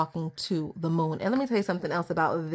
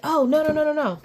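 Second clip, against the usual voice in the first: dismayed realization; 9 semitones higher, faster than usual. Real, not acted. Reader suddenly remembering the forgotten letters, dismayed realization. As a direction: rush the no's, then squeal the question.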